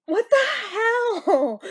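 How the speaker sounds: high, slow, laughing outcry; shocked laughing cry. Real, not acted. Reacting to very sour taste.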